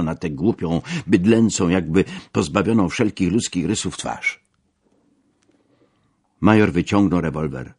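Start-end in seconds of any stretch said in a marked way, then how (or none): none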